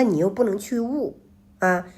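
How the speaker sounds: usual speaking voice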